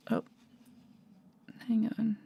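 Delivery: hushed aside to the audience; whispered aside. Real, not acted. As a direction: whisper "hang on" off-mic. Technical problem mid-call.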